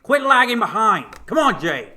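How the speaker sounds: loud, high northern coach bark. Retold or imitated. Coach yelling at a lagging runner in a northern accent. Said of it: "He's from the north".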